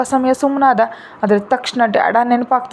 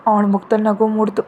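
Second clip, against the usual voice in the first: ordinary delivery, nothing marked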